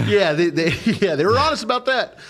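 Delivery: real laugh breaking up the words; amused agreement through laughter, pitch climbing high. Real, not acted. Laughing at listener's joke.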